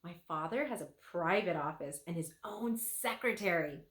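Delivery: ordinary delivery, nothing marked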